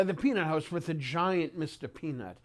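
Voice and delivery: normal talking voice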